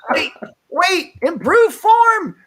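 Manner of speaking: high, loud, thrilled reading; excited. Real, not acted. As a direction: burst out with glee. Excited at a new ability.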